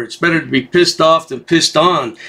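normal talking voice

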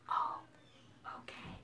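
very soft whispering; secretive, barely voiced hush. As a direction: whisper so the sleeper doesn't wake. Avoiding waking the sleeping dinosaur.

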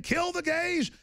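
high, sneering quoted order; mock imam quote. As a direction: spit out the imam's words mockingly. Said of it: "his imam saying".

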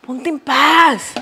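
slow, drawn-out mock scolding; scolding the pot. Real, not acted. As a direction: tell the pot to behave, slowly. (regaño lento a la olla)

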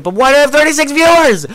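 very high, loud disbelief; incredulous squeal. Real, not acted. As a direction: shriek it in baffled amazement. Disbelief at viewer count.

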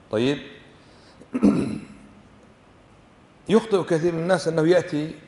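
ordinary talk, nothing marked

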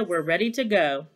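ordinary delivery

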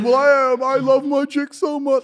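high, loud, drawn-out mimicry; mock devoted boyfriend. Mimicking dudes who adore their girlfriends, mock devotion. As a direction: put on a hammed-up lovesick whine. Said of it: "a bunch of dudes going".

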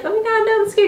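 high, mock-frightened squeal; scared little self. The scared younger self refusing the new thing, squeaky mock fear. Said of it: "they're gonna be like".

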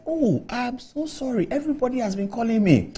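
imitating himself begging pardon; pleading apology. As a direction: plead sheepishly with a senior. Apologising to the angry senior.

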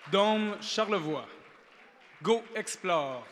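slow, loud finalist announcement; booming prize announcer. Acted. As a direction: call out each finalist slowly and proudly. Gala host announcing prize finalists. (annonceur de gala lent et sonore)

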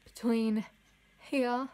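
speaking with finger in mouth; finger pulling lip down. Real, not acted. Seen 1: Finger pulls lower lip down exposing braces, 0.1–1.4s.

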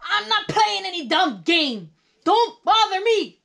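high, loud irritated outburst; grouchy yelling. A narrator's reading. Grouch refuses to join the game, yelling. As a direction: shoo them off furiously. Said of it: "yelled Oscar".

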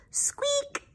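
very high toy squeak; squeaky toy sound. Acted. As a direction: squeak like a rubber duck. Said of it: "says the little rubber duck".

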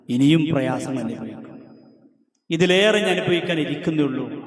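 thundering sermon voice; fiery preaching. Acted. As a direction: build to a roaring pulpit climax. Preaches the letter's words.

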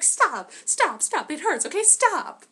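high, loud whiny begging; pleading minion. Retold or imitated. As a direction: beg like a beaten goon. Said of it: "he's like".

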